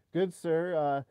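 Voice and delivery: mock the caller's scripted politeness; mocking a phone scammer's scripted greeting; scam caller voice.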